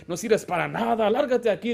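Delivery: lightly acted angry dismissal; insulting parent. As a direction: imitate a harsh parent berating a child. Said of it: "he visto a padres tratarlo de hijo inservible".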